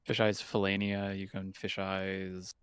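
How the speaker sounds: usual speaking voice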